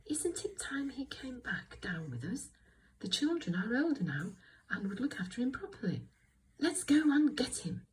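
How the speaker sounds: high little voice; light, high storybook voice; Little Bear. Shown as a demonstration. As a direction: read it as a small, high-voiced character. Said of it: "Little Bear, little voice".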